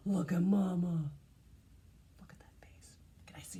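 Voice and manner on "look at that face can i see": whisper. Real, not acted. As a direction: hush it like a secret.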